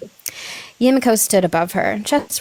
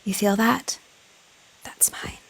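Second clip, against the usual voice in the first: very soft unvoiced whisper; 8 dB quieter than usual. A narrator's reading. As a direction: breathe it close into the ear. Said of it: "just enough to whisper".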